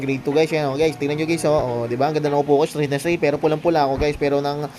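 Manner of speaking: a hint of a mom at school pickup; mother voice. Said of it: "Kunwari, ako yung nanay".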